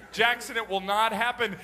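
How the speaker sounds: normal talking voice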